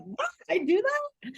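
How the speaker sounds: high-pitched incredulous squeak; mock surprise. Teasing a colleague by acting out her realization, mock surprised. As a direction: play astonished at your own habit.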